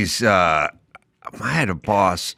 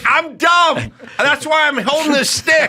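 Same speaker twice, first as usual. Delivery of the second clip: dopey self-mockery. Worker explaining himself to angry boss, high goofy voice. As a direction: own it like a happy fool. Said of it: "And I'm like".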